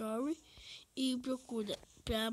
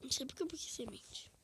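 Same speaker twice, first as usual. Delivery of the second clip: whispering; 5 dB quieter, faster than usual. Real, not acted. Whispered aside.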